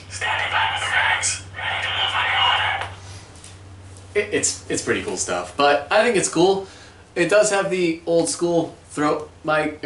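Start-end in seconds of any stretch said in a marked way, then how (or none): 0.1-4.3 whispered radio reply; whispering. Retold or imitated. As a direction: whisper it like a stealthy operator. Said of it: "And you're like".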